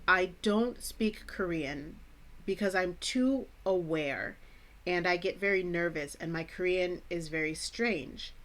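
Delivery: ordinary talk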